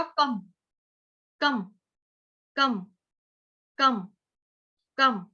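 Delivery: कम with full क and clipped half म; pronunciation drill. Shown as a demonstration. Teacher drilling the ending of मामकम for students. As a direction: repeat कम, cutting the म short. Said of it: "ये वाला म आधा है".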